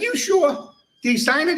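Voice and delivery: high and sing-song, fake concern; mock-sympathetic high voice. Teller baiting the annoyed chief, mock-sympathetic high voice.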